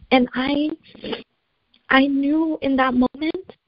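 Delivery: holding back tears while going on; tearful. Real, not acted. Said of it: "I'm gonna cry".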